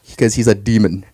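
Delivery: low, dry mock verdict; mother's verdict. Mother explaining why, low dry verdict. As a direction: drop it low like a curse. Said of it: "you ask him".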